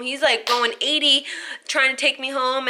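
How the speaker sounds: ordinary talk, nothing marked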